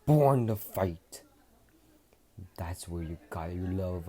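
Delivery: rally the weakling into a warrior; Vegeta lecturing. Acted. Vegeta lectures Midoriya on Saiyan pride, loud fierce voice. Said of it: "Vegeta says".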